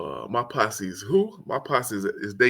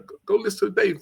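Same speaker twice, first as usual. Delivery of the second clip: fast insisting friend; 10 semitones higher, much faster than usual. Retold or imitated.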